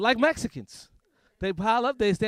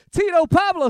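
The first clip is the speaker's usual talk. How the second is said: calling out; 10 semitones higher, 14 dB louder than usual. Pretend. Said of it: "I'll be like".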